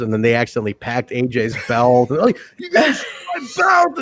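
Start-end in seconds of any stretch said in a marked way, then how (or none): 2.6-4.0 high, slow whiny wail; mock panicked cry. AJ discovering his belt was shipped, high whiny mock wail. Voicing AJ in old commercial.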